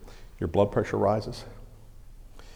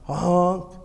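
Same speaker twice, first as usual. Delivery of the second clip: a quick honk sound, pitched up; imitated horn honk; 7 semitones higher than usual. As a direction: mimic the truck horn answering. Imitating dad's truck horn.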